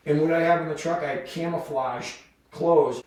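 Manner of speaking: normal talking voice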